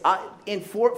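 usual speaking voice

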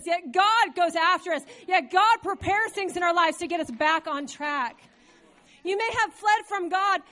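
emphatic sermon delivery, punched loud on key words; forceful preaching. Real, not acted. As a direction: preach it out to the congregation with conviction.

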